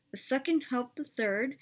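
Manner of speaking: usual speaking voice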